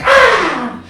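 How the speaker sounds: very high, loud mock squeal; comic high-pitched shriek; startled yelp. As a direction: squeal as if caught off guard. Own startled reaction to Daddy.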